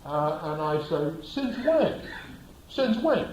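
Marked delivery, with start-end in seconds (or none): 1.2-3.3 indignant. Retold or imitated. Driver confronts cop over ticket.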